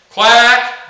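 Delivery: loud, drawn-out quack pitched high. Shown as a demonstration. Coach showing the top of a cadence, high pitched. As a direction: sing out a long high quack. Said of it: "going from a high pitch".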